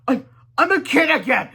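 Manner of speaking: high, loud amazed; high loud astonishment; stunned amazement. Acted. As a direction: gasp it out in shock. Man discovers kid body.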